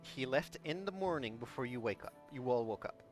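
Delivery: normal talking voice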